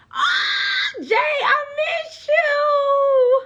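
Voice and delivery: high, loud crying-out greeting; drawn-out joyful wail of missing someone; excited cry. Real, not acted. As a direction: squeal it out like you've missed them badly. Greeting a missed viewer, crying out.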